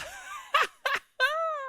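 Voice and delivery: high shrieking laughter. Real, not acted.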